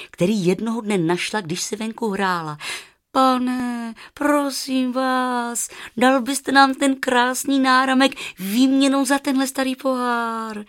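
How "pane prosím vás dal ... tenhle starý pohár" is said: put-on high-pitched little girl pleading; drawn-out, high childish entreaty; begging. A narrator's reading. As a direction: beg the gentleman in a child's voice. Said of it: "žadonila".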